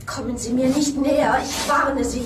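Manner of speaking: loud, high, panicked warning; cornered woman yelling a warning at the killer; frightened warning. Acted. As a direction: shout him off you in fear. Victim warns approaching killer.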